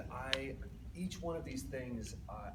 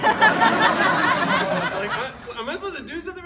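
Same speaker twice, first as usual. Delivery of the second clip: much higher and louder, laughing; flustered new dad; laughing panic; 19 dB louder, slower than usual. Retold or imitated. New dad to the nurse, laughing in panic.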